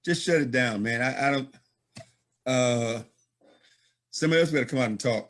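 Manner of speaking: usual speaking voice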